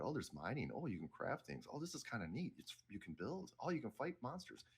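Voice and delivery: lilting up as each new thing dawns on you; retold dawning wonder. Recalling his first time playing the game, mock wonder.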